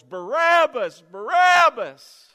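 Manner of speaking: loud, high, slow chant of a name; crowd yelling. Acted. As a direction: roar the name like an angry mob. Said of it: "the crowd yells".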